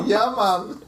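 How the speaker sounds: put-on laid-back Jamaican accent; easy Jamaican accent greeting. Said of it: "So that was Jamaican".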